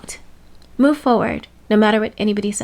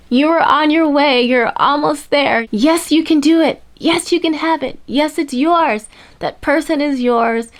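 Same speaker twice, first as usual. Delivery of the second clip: higher, emphatic pep talk; rousing encouragement; 3 semitones higher, 4 dB louder than usual. Real, not acted. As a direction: cheer them on with rising conviction. Cheering the listener on.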